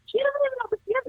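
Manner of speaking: aunt gasping out the news, astonished; stunned aunt. Retold or imitated. Aunt telling her niece about grandma, in high astonished disbelief. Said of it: "And she's like".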